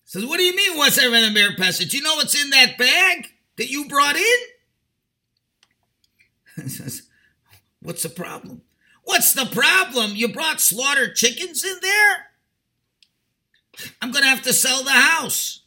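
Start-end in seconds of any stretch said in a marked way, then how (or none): 0.2-4.4 much higher and louder, rushing in disbelief; outraged outburst. Acted. Father confronts neighbor over smelly bag. 9.0-15.6 much higher and louder, incredulous; furious echo. Acted. Father echoes back in fury.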